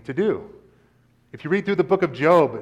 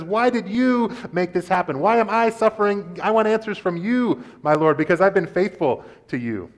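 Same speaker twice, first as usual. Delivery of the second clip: higher, pleading demand; demanding Job; 6 semitones higher than usual. Acted. Job demanding answers from God, higher and pleading.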